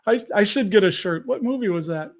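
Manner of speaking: ordinary delivery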